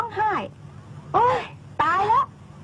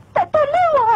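much higher, louder tearful cry; breaking down; 12 semitones higher, 5 dB louder than usual. Acted. Girl breaking down before her confession, crying out. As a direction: let it burst out.

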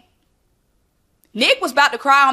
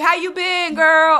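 high, bright greeting to a friend; imitating a friend asking; 7 semitones higher, 3 dB louder, slower than usual. Friend greeting someone who is down bad, high cheery ask. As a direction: toss off a cheery "how you been". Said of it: "somebody asks you".

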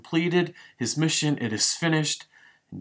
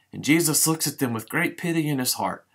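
usual speaking voice

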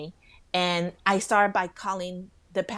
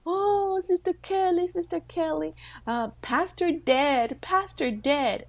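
retold crying; 9 semitones higher than usual. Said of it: "they're crying and they're saying".

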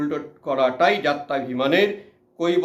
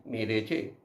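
dismayed aside; 8 semitones lower, 9 dB quieter, slower than usual. Acted. Narrator dismayed at French question.

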